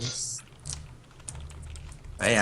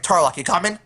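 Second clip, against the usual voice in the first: calling out; 6 semitones higher, 5 dB louder than usual. Acted. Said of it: "I was like".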